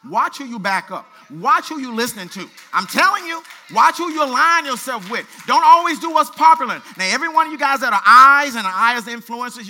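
loud, high, driving preacher's call; full-force preaching. Acted. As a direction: hammer the warning home to the congregation.

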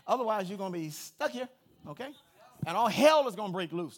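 loud fired-up preaching, pushing every line; preaching at full force. Acted. Pastor fires up the church, preaching loud. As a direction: preach it hard to the congregation.

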